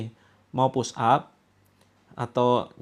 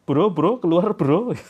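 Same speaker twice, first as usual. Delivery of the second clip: louder, higher mock slang; slang buddy; 5 semitones higher, 6 dB louder, slower than usual. Shown as a demonstration. Mocking the wrong slang way to address a jinn, matey. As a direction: call the jinn out like a casual buddy. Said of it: "Jangan pakai bahasa gaul juga".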